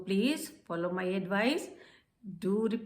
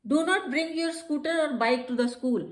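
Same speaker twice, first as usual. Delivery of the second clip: slow, louder firm instruction; 6 semitones higher, 4 dB louder, much slower than usual. Shown as a demonstration. Teacher demonstrates a school rule instruction, firm. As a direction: state the rule firmly. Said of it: "फिर हम क्या कह सकते हैं?".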